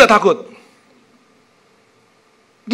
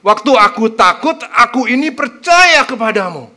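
louder, earnest proclamation of the verse; heartfelt declaration; 5 semitones higher, 8 dB louder than usual. Shown as a demonstration. Preacher proclaiming the verse aloud to show its power, earnest and loud. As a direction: declare it with full conviction. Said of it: "Coba lihat, keren nggak?".

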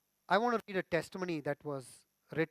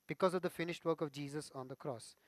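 usual speaking voice

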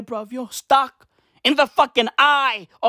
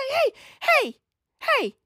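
squeaky hey, hey, hey, much higher; high calling voice. Acted. Comedian hailing an imagined listener, high put-on call.

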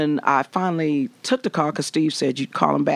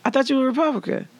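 mock surprised interviewer; 10 semitones higher, faster than usual. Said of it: "And they was like".

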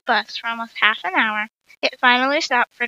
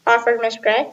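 ordinary delivery

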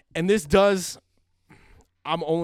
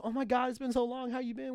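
high, softer gushing imitation; mock catching-up; 8 semitones higher, 6 dB quieter than usual. Old friends greeting after so long, mock excitement.

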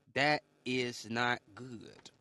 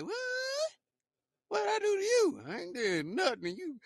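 shocked what. Retold or imitated. Victim cursed out for nothing, shocked protest. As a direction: shriek it in stunned disbelief. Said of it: "And you be like".

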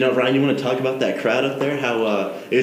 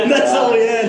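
laughing; 12 semitones higher, 5 dB louder than usual. Real, not acted. Laughing at their own barking story.

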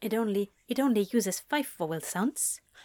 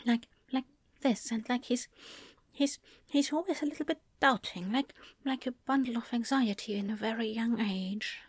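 put-on higher, pouty little-boy voice; anxious young child, higher and hesitant; pouting shy child; 4 semitones higher than usual. Shown as a demonstration. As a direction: talk with a pout in the lower lip. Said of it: "a little bit of a pout in his lower lip".

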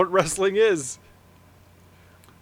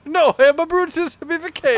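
mock wrestler boast; 9 semitones higher, 4 dB louder than usual. Wrestler impression.

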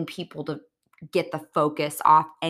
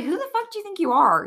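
much higher, louder, fast retold outburst; angry outburst; 14 semitones higher, 5 dB louder, faster than usual. Katie rounding on Raquel, much higher, louder, fast outburst. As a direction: fire it back at her, outraged.

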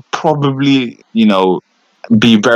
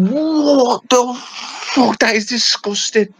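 high, very slow, drawn-out disgusted disbelief; reliving a gross moment, hammed up; disgusted shock; 13 semitones higher, much slower than usual. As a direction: stretch it out in appalled shock. Retold disgust at unwashed hands.

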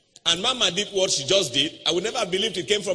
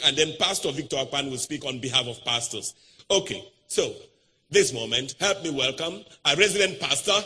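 ordinary delivery

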